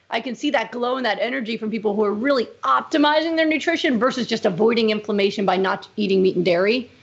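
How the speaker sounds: normal talking voice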